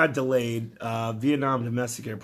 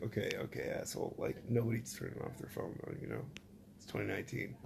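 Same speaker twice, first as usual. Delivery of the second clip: under-breath grumble; 4 semitones lower, 13 dB quieter, faster than usual. Retold or imitated. Muttering back at the steward.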